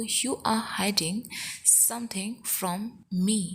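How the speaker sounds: chunked, with a pause midway; measured teaching delivery. Shown as a demonstration. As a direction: break the sentence up for learners. Teacher repeats sentence in slow chunks.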